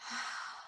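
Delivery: long, soft sigh; audible breath out. Shown as a demonstration. Meditation guide shows listeners how to notice breathing, soft sigh. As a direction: let a breath out slowly and softly.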